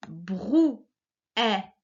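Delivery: "Brouette" in three beats, brou-è-te; "Brouette" divided brou / è / te with gaps; split into syllables. Shown as a demonstration. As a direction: sound out "Brouette" one syllable at a time. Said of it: "la première syllabe de chaque mot".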